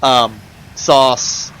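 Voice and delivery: ordinary talk, nothing marked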